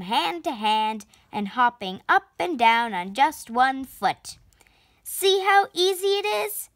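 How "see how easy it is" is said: showing off, pitched much higher; exclaimed. A narrator's reading. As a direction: boast it in a bright, raised voice. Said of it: "she exclaimed".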